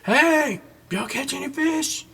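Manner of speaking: high, calling out across the water; a friendly shout from the beach to fishermen. Acted. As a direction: hail the boat from the shore. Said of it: "He yells out to him".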